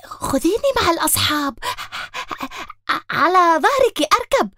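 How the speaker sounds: a hint of a high, sweet pleading dog; polite puppy voice. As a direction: ask nicely like a well-mannered puppy. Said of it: "بكل لطف وأدب".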